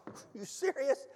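high, hushed mock disbelief; stunned disbelief. Husband answering wife's news of money, stunned disbelief.

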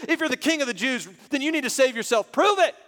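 loud, high soldiers' taunt, peaking at the end; mocking. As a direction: shout it as a scornful challenge. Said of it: "the soldiers mocked him and said".

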